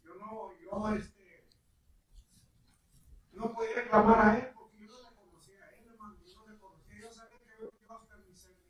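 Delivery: loud, surging preacher's outbursts; preaching at full force. Acted. Preacher telling the congregation his testimony, loud bursts.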